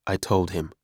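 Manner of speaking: ordinary delivery, nothing marked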